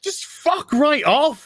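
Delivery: high, slow, fed-up mock voice; exasperated dismissal. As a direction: sneer it like a fed-up fan. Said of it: "people are just like".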